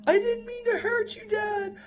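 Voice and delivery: put-on high tearful child's voice, sobbing out an apology; crying son. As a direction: play the little boy, sorry and crying. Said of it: "He's like".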